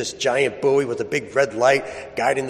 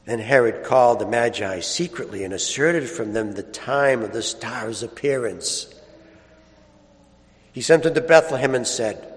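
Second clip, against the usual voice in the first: solemn Gospel reading. Acted. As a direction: read scripture with hushed reverence. Solemn Gospel reading, held style.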